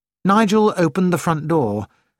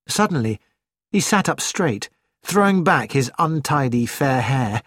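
usual speaking voice

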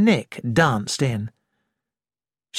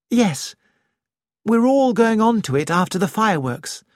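Nick voiced higher, lively young woman; a lightly raised, lively young woman's voice; 7 semitones higher than usual. A narrator's reading.

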